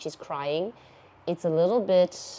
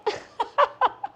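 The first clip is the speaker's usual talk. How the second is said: high-pitched embarrassed giggle; squeaky laugh. Real, not acted. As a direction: giggle at your own silliness. Laughing after fangirling.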